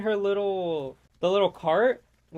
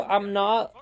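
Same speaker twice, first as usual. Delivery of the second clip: ordinary talk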